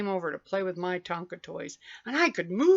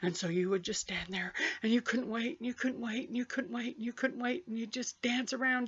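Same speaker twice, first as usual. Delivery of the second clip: hushed; 2 semitones higher, 9 dB quieter than usual. Retold or imitated. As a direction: hold it down, anxious and quiet. Hushed waiting in story.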